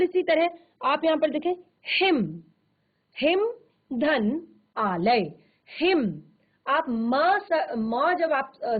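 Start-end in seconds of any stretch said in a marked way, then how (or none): 3.2-5.3 हिम धन आलय said slowly, each part apart; slow separated parts. Shown as a demonstration. Teacher slowly splitting the word for students. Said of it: "sounding out sandhi parts".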